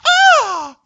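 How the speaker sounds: a hammed-up startled shriek; mock scream. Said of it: "and she goes".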